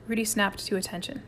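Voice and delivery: usual speaking voice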